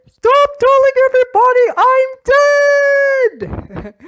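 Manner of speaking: high, loud, slow shout; shouting from the back. Acted. Someone in the back screaming at mourners, high and slow. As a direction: scream it, furious at being mourned. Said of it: "somebody in the back going".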